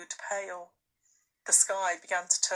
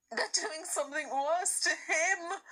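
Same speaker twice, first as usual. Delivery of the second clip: put-on worried girl; 9 semitones higher than usual. A narrator's reading. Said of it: "said Lucy".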